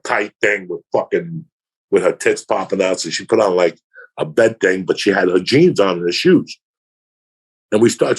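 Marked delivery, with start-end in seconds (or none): none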